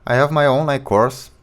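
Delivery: ordinary delivery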